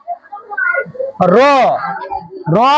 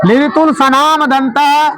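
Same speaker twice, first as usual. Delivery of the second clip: slow recitation. Shown as a demonstration. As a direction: slowly say "लिरितुल Sanam दंतः", one syllable at a time. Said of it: "क्या पढ़ेंगे?". (धीरे-धीरे पढ़कर सुनाना)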